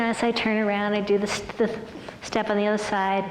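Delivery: ordinary delivery, nothing marked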